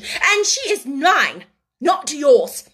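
high, loud, slow emphasis; possessive scolding. A narrator's reading. Headmistress claims the orphan's doll, angrily. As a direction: assert ownership word by word.

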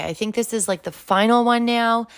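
ordinary talk, nothing marked

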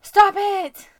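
sudden high yell; loud high protest; shout. Real, not acted. Protesting a dark joke.

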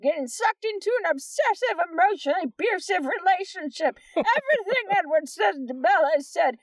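high, lively mocking read-aloud. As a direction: read it like a snooty reviewer. Reads a review aloud in a voice.

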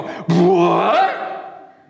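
scandalized guests shouting at Jesus; outraged protest. Acted. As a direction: build from shock to a furious challenge. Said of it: "And they all just go".

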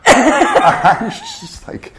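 stunned laugh breaking into the line; laughing in disbelief. Real, not acted.

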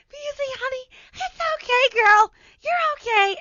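very high coddling mom voice, growing loud; mock soothing squeal. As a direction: coo shrilly at a pet, hammed up. Acts mother soothing the cat.

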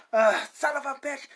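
piss-poor Bob Goldworth impression. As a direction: curse like a cranky old man. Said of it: "my piss poor, uh, Bob Goldworth, uh, impression".

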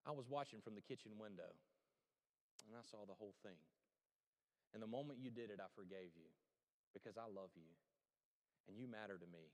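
a hint of a tender grandma forgiving the boy; soft tender grandma. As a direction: speak it low and kind like a loving grandmother. Grandma's tender reply in story.

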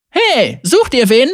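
high, punchy call to the henchmen. Acted. Young woman challenging approaching henchmen, calling out high. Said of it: "rief Zeri den Lakaien zu".

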